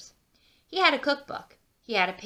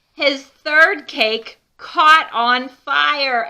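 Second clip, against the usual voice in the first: loud, high, slow dramatic build; drawn-out loud storyteller gasp; dramatic alarm; 6 semitones higher, 13 dB louder, much slower than usual. Acted. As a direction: sound the alarm like it's a big deal. Dramatic fire moment in story.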